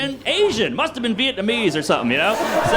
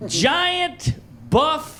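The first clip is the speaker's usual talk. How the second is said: ordinary delivery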